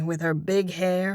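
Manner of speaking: ordinary delivery, nothing marked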